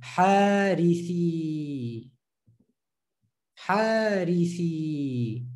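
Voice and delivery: Harithi slowly, three units joined; slow joined reading. Shown as a demonstration. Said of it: "Three units joined together".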